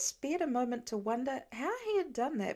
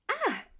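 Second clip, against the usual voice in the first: sharp high yelp of surprise; startled cry; 12 semitones higher, 3 dB louder than usual. A narrator's reading. Laura stepping in a cold puddle, startled yelp. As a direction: jump back with a sudden shriek. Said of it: "leapt backwards with a cry".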